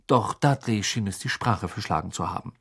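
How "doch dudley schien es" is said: ordinary delivery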